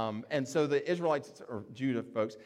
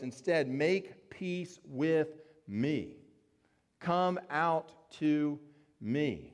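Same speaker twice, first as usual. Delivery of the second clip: drawn-out words with weighty pauses; slow, deliberate appeal; 2 semitones higher, much slower than usual. Acted. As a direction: spell out the demand slowly. Envoy urging the besieged to surrender.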